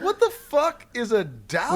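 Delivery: high, incredulous retold question; baffled outburst. Players reacting to Waka's odd word, baffled. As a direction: blurt it in hammed-up disbelief.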